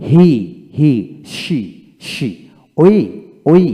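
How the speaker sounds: "হি", "শি", "ওই" each with a loud, drawn-out ee; long ee. Shown as a demonstration. Teacher drills words for learners to repeat, long ee. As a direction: hold the ee in "হি", "শি", "ওই". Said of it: "দীর্ঘিকার হবে".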